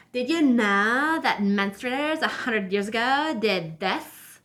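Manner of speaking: put-on swooping valley-girl lilt with stretched vowels. Vlogger correcting her quote of a woman, mocking valley-girl voice. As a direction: imitate a dumb-sounding valley girl. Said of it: "She actually talks like that too".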